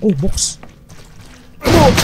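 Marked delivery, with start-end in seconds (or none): 1.6-2.0 high startled yelp. Real, not acted. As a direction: jump at the sight. Sudden scare.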